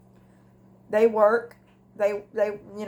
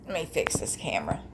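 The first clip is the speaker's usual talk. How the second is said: soft whispered aside, much lower; 7 semitones lower, 8 dB quieter, faster than usual. Real, not acted. Muttering to herself while fixing the camera, whispered. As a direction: whisper it to yourself while fiddling.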